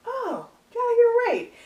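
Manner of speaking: high-pitched imitation of dawning realisation; mock realisation. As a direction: act out a customer caught off guard. Acts out surprised customers' realisation.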